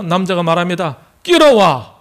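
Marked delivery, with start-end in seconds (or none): 1.2-1.9 high, loud, falling Gyeongsang outburst; gruff Gyeongsang man. Acted. Gyeongsang man answering his girlfriend, loud Gyeongsang bluntness. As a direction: dismiss it in blunt Gyeongsang dialect. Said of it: "경상도 남자가".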